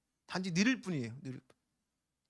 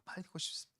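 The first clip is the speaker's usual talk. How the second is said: softer echo, breathed without voice; whispered repeat; 2 semitones lower, 11 dB quieter than usual. Retold or imitated. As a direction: whisper the wish back to yourself. 같은 말을 속삭여 반복. (같은 소원을 숨소리로 속삭이며)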